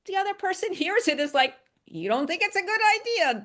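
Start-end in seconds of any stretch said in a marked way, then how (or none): 1.8-3.4 higher, quicker voiced peer reading it as a technical remark; voicing the peer. Peer explaining what the colleague meant, in a higher, quicker voice. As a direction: lightly act out the peer's interpretation, up and quick.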